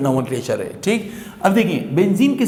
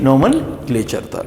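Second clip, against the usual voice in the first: ordinary delivery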